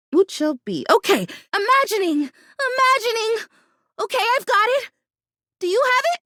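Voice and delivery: ordinary delivery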